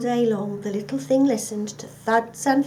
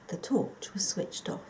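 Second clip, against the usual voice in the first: ordinary delivery, nothing marked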